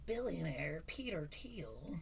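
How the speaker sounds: breathy whisper of the name; whispered aside. Real, not acted. To co-host, whispering the billionaire's name. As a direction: drop the name in a hushed whisper.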